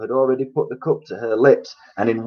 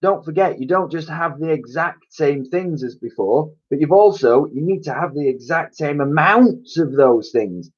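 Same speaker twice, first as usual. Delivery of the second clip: put-on raised excited child voice; lightly voiced eager child pleading; character voice; 6 semitones higher, 3 dB louder than usual. A narrator's reading.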